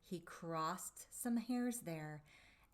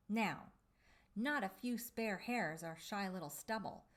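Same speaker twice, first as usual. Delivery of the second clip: ordinary delivery, nothing marked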